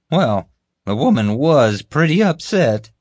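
slow, high, loud hedging; admitting guard. Acted. Guard admitting the accident to his boss, reluctantly. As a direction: drag it out as a reluctant admission. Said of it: "Kevin admitted".

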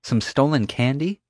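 high rising disbelief; as if it can't be that small; incredulous. A narrator's reading. Summer scoffing at the candy theft, incredulous.

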